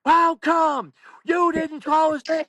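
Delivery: high, loud mock parental outrage; screaming. Parents in the front row at the announcer, screaming. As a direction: imitate shrieked complaint from the front row. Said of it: "they're screaming".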